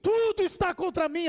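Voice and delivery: high, sustained preacher's cry; hammed-up high-pitched lament; anguished cry of Jacob. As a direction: cry out as a bereft father. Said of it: "Jacó então grita as palavras".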